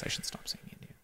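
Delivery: a quick whispered self-correction; whispered aside. Real, not acted. Reading the quiz question, mutters a whispered aside to herself. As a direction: whisper it to yourself.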